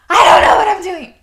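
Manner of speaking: loud, high mock-crying outburst; tearful panicked wail. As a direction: wail it, hammed-up, on the edge of tears. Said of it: "about ready to turn into tears".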